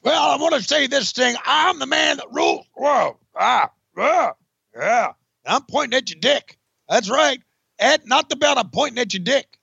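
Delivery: high hammed-up shouting Sid promo; Sid Vicious. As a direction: bellow it like an unhinged heel wrestler. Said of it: "This is Sid Vicious".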